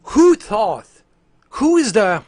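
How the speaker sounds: high, loud burst of disbelief; exasperated outburst. Acted. Streamer fuming at Microsoft's decision, exasperated outburst. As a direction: start to rant, then cut it short.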